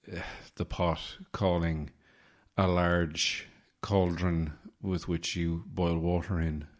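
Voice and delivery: ordinary delivery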